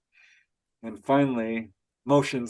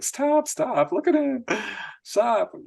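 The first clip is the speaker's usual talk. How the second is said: much higher, urgent calls to stop; a girl begging dad to pull over; urgent excited cry; 12 semitones higher, slower than usual. Retold or imitated. Daughter urging father to stop.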